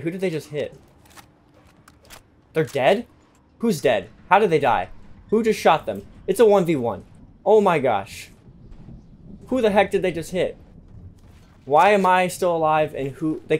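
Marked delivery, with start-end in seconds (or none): none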